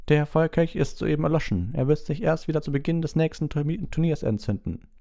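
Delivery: high-voiced explanation; explaining official. A narrator's reading. (erklärender Beamter, hohe Stimme)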